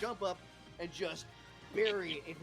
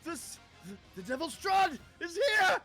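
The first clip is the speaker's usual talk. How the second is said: high, loud panicked cry; terrified shout; 14 semitones higher, 7 dB louder than usual. Acted. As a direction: scream it as you flee. GM voices fleeing guards.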